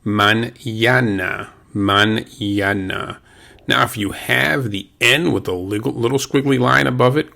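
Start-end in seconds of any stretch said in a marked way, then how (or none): 0.0-3.2 slow mañana, ñ as NY; mañana with the NY sound of ñ; Spanish ñ. Shown as a demonstration. Teacher modelling mañana slowly for students. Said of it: "the N is pronounced as if it were an N Y".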